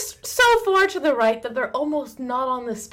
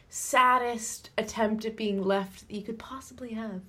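soft scornful whisper; 7 dB quieter than usual. Real, not acted. Mocking weak left politics.

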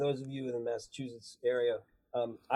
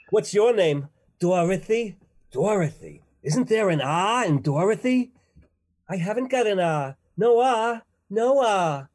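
loud, raised-pitch Boston voices; broad Boston, hammed up and R-less; Boston accent; 7 semitones higher, 8 dB louder, slower than usual. Wizard of Oz characters argue over Dorothy's name, loud Boston accent. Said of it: "half the people in that cast".

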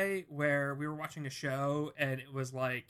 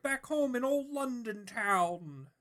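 high, put-on old London codger; old man voice; 7 semitones higher than usual. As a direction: play a cheery old Londoner reminiscing. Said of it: "you're just doing an old man voice".

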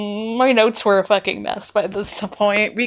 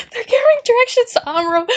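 very high, fast excited squeal; bursting excitement; 12 semitones higher, much faster than usual. Real, not acted. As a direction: repeat it in a rushing, thrilled squeak. Repeats it with mounting excitement.

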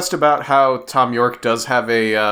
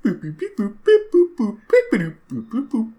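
high squeaky boop beep imitation; toy robot voice; 11 semitones higher than usual. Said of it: "that's the entire song".

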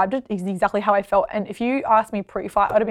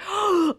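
sharp high intake of breath; acted-out gasp of shock; startled gasp; 8 semitones higher, 5 dB quieter than usual. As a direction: gasp as if suddenly panicked. Acts out her shock in ring.

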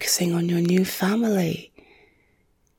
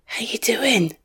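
ordinary talk

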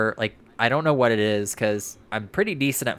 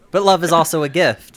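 mock sentimental; 6 semitones higher, 8 dB louder than usual. Sentimental holiday declaration.

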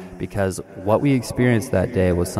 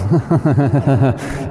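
real laugh; 5 semitones higher, 6 dB louder than usual. Real, not acted. Laughs at own bit.

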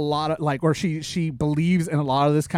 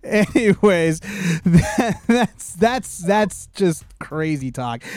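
ordinary talk, nothing marked

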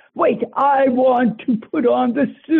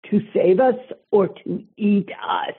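usual speaking voice

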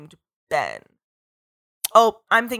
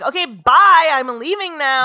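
brisk dismissive exit; 4 semitones higher, 6 dB louder, slower than usual. Retold or imitated. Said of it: "And she'll be like".

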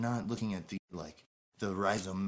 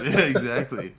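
loud laughing yeah; 5 semitones higher, 12 dB louder than usual. Real, not acted. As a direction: laugh through the words. Laughs while agreeing.

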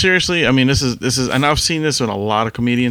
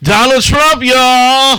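pitched way up, loud hack-comic hype; mock crowd-work yell; 11 semitones higher, 6 dB louder, much slower than usual. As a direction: shout it like a cheesy club comic opener. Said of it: "he's the same guy that's gonna go".

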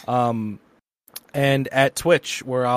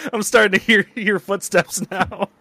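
laughing; 6 semitones higher, 4 dB louder than usual. Real, not acted. Laughing at his own ghost story.

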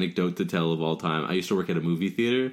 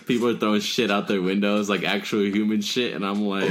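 normal talking voice

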